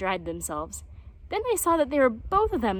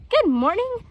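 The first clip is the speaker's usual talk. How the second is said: cheeky greeting; 11 semitones higher, 7 dB louder than usual. Acted.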